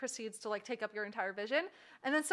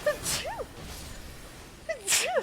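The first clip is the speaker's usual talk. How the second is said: sneezing; slower than usual. Real, not acted. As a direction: sneeze hard twice. Real sneezing fit.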